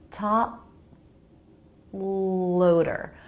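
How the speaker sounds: "Top loader" with a long stop on the P, then into the L; unreleased P, held. Shown as a demonstration. Teacher showing the stop, "Top loader" split at an unreleased P. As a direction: break "Top ... loader" at the closed lips, P never released. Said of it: "top loader looks like this".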